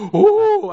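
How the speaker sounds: sudden high loud "uh!" to startle; mock scare yell. Preacher playfully shouts "uh!" to scare someone, sudden high shout. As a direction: jump out with a big "uh!".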